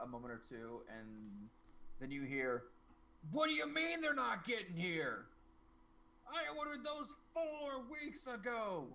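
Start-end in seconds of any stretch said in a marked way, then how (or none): 3.3-8.9 high, strained yell, slowing at the end; outraged shouting. Acted. Leonard raging behind a slammed door, shouting. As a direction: bellow each word in rising outrage.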